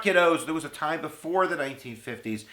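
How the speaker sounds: ordinary delivery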